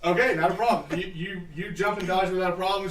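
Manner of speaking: ordinary talk, nothing marked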